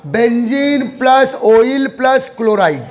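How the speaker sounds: Benzene, oil, chloride said slowly, each part set apart by plus; name built part by part. Shown as a demonstration. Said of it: "उसके पीछे का logic बता रहा हूं".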